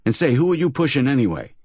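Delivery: high, fast affronted protest; mildly affronted protest; indignant protest. Acted. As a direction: push back, offended. Tall man protests being pushed.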